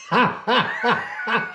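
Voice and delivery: high laughing shout through the words; helpless laughter. Real, not acted. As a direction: crack up while pointing. Real laughter watching owl video.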